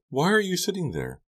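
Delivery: high-pitched, startled questioning; teen's surprised outcry; startled cry. A narrator's reading. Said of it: "Becca cried".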